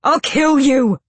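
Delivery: high, loud retold scream. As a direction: scream it at him. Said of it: "Sam had screamed at Arobin".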